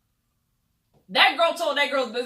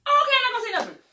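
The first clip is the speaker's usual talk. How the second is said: 1 mock promise; 11 semitones higher, 5 dB louder, much faster than usual. Mocking Malaysia's fake promise.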